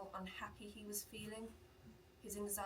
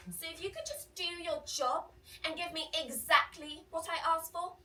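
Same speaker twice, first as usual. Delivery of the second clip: entitled customer voice; 6 semitones higher, 10 dB louder than usual. Retold or imitated. Customer lectures the barista loudly.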